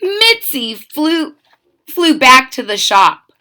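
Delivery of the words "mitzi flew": usual speaking voice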